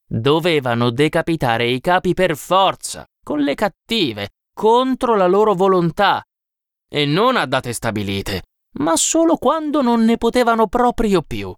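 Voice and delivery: a hint of insistent explaining; emphatic old man. A narrator's reading. Old man tells how chiefs were beheaded, lightly insistent.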